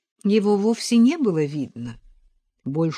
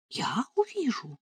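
high, hushed question of astonishment; soft gasp of amazement; amazed whisper; 8 semitones higher, 7 dB quieter than usual. A narrator's reading. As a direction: whisper it in disbelief. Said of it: "Параша изрядно изумилась".

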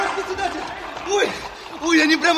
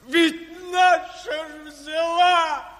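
anguished cry; 3 semitones higher, much slower than usual. Acted. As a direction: cry out the bitter victory. Крик горя и победы над телом. (горестный крик)

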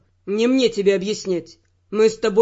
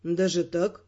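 low, soft question with a frown in it; quietly disapproving, lower and softer; 4 semitones lower, 7 dB quieter, slower than usual. A narrator's reading. Partner reacting to her request for secrecy, frowning. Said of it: "нахмурился Тео".

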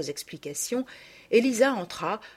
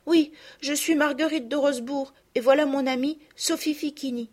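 put-on higher, a polite little girl; a small girl explaining herself; little girl's voice; 8 semitones higher, 5 dB louder than usual. Lost girl answers the carter, in a higher child's voice.

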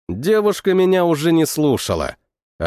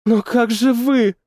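put-on worried, high young woman; 8 semitones higher, slower than usual. Girl's worried question.

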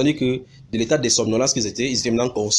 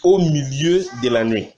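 deliberate proclamation of scripture; emphatic verse reading; 4 dB louder, slower than usual. Acted. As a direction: announce the verse with weight on the opening. Reads the underlined verse out. (lecture solennelle du verset)

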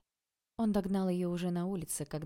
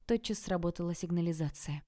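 ordinary delivery, nothing marked

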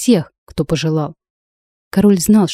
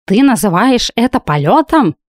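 higher indignant cat howl; 4 semitones higher than usual. A narrator's reading. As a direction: wail it as an outraged cat. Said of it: "перебивая, взвыл кот".

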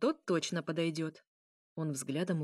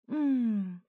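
higher, louder "mmm" of delight; moan of pleasure; 4 semitones higher, 5 dB louder than usual. A narrator's reading. Vampire princess drinking at last, moaning with pleasure. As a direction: moan with relish, sliding down. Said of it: "застонала от удовольствия".

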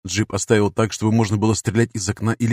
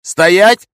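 loud, high command to halt; sudden raised-voice order; sharp shout; 10 semitones higher, 10 dB louder than usual. A narrator's reading. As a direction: shout it abruptly at gunpoint. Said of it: "резко крикнул я".

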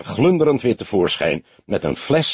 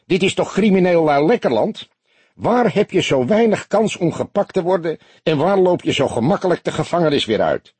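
lightly higher, jolly and mocking; 9 semitones higher than usual. A narrator's reading. Barkeeper joking to the detective about crooks, lightly jolly. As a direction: rib him cheerfully. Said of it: "riep hij jolig".

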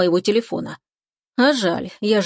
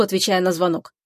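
ordinary delivery, nothing marked

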